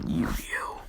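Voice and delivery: whispered to himself; a secret whisper only he can hear; inner voice whisper. Acted. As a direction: breathe it like a private thought. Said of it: "In his head, he says".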